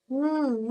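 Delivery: a soft, short hummed म, said as a bare sound and not as the letter name. Shown as a demonstration. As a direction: model the म sound for children. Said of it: "इसकी sound है म".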